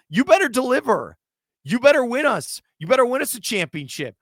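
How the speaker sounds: a host demanding results from paid athletes, raising his voice; emphatic rant. Real, not acted.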